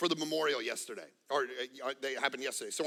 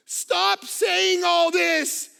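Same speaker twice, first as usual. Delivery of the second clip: high, loud, slow yell from another room; daughter yelling from afar; 11 semitones higher, 11 dB louder, much slower than usual. Retold or imitated. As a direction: call out across the house, laughing. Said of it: "yells from the other room".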